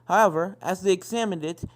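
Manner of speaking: ordinary delivery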